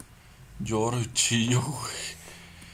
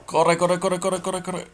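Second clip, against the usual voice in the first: high, loud frantic urging; panicked; 7 semitones higher, 8 dB louder than usual. Real, not acted. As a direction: hurry yourself, panicking. Panicked urging while fleeing.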